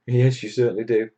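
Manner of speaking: ordinary talk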